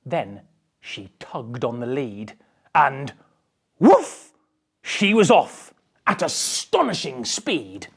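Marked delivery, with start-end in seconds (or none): 3.8-4.3 a high, loud playful dog bark; woof bark. Acted.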